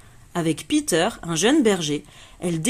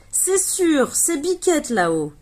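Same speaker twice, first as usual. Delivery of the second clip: higher, certain, pleased; sure and excited; 8 semitones higher than usual. Acted. Paloma spotting Biquette up the tree, high and excited. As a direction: announce the find with glee.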